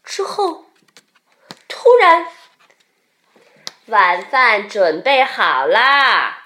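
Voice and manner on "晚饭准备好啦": loud, slow, drawn-out dinner call; mother bear calling. A narrator's reading. Mother bear calls her cub to dinner, calling out. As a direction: sing out to a child far off. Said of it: "熊妈妈招呼着".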